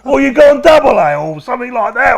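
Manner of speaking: mock prison guard shouting orders; imitated guard yelling. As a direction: bark it like an angry guard. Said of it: "They said".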